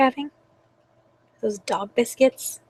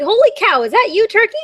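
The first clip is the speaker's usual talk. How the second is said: higher-pitched, surprised pig; pig character voice; 9 semitones higher, 7 dB louder than usual. Acted. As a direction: exclaim it as a storybook pig. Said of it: "snorted Pig".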